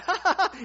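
loud, high mock laughter; acting out helpless laughing; imitated laugh. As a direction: burst into a put-on belly laugh. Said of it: "you start laughing".

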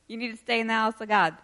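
ordinary delivery

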